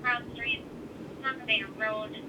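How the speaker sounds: normal talking voice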